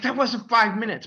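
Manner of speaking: high, loud indignant cry; outraged disbelief. Retold or imitated. Fleeing student protesting the early bell, indignant. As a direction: protest that you were cheated. Realizes bell rang too soon.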